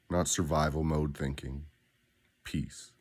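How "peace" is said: breathy whispered single word. Acted. Coach closes the affirmation on one word, whispered. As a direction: let the word fall to a whisper.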